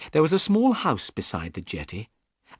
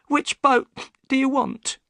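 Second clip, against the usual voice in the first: high-pitched upset woman; high female character voice, on edge; Missus Smith, upset; 3 dB louder than usual. A narrator's reading. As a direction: voice a troubled wife, pitched very high.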